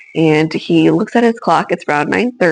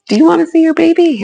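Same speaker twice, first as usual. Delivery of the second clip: cheerful doctor; 10 semitones higher, 3 dB louder, faster than usual. Retold or imitated. Doctor offering to show baby.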